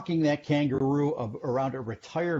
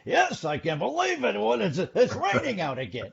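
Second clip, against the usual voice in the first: light, high morning-host voice; a hint of a chirpy morning host; Regis Philbin; 9 semitones higher than usual. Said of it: "I could be the Regis Philbin".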